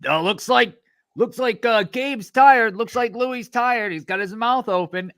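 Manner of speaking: mock commentator, pitched well up; imitating a commentator. Podcast host mimics commentators spotting a tired fighter, high mock commentary. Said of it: "the commentators need something to talk about".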